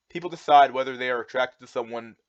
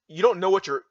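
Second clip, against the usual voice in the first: ordinary delivery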